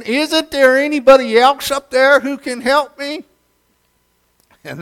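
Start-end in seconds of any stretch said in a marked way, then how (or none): 0.0-3.2 high, loud scream with a rising end; screaming again. Retold or imitated. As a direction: plead once more, voice climbing at the end. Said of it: "screamed again".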